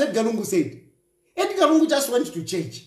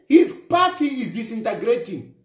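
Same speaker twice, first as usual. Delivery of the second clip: usual speaking voice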